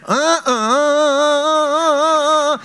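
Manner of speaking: a stuttering car noise, drawn out; imitated sputtering engine. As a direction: mimic the engine grinding and failing to catch. Engine failing to restart again.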